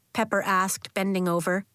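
ordinary delivery, nothing marked

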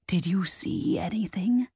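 hushed, whispered question. A narrator's reading. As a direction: ask it in a breathy whisper. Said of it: "asked in a hushed tone".

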